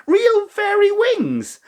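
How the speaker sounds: high, slow, thrilled question; excited. Acted. Twins asking Rose about fairy wings, high and thrilled. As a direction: marvel at the idea of real wings. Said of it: "said the twins excitedly".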